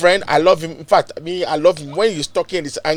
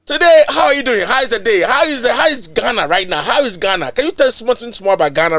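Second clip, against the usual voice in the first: higher, louder eager asking; lively questions; 7 semitones higher, 5 dB louder than usual. Real, not acted. Host asking his guest about Ghana, eager and lively. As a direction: pepper him with questions.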